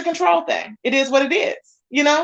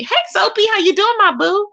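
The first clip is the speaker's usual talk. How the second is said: pitched up, sing-song hello; bubbly greeting; 8 semitones higher than usual. Real, not acted. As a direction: greet a favorite viewer brightly. Greeting a viewer.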